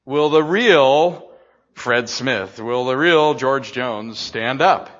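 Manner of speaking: drawn-out host call, loud on real; a hint of a game-show host. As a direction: boom it like a TV host. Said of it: "Then you gotta say".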